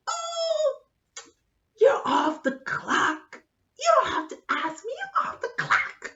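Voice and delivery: very high, loud squeal; exaggerated protest. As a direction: push him off in a strained high voice. Brushing off drunk doctor's questions.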